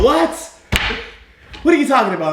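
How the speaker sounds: high, loud disbelief; incredulous. Acted. Boss caught off guard about interviewing applicants, incredulous. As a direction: balk loudly, pitch jumping up.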